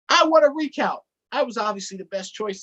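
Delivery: loud, high-pitched demand for a recount; indignant protest. Acted. Donkey demands a recount from the winning mouse, indignant. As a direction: burst out high and loud, then ease off.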